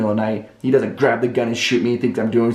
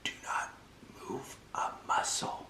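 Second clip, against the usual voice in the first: tiny breathless self-warning, word by word; frozen whisper; 20 dB quieter than usual. Retold or imitated. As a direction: whisper it without daring to breathe. Barely breathing beside sleeping woman.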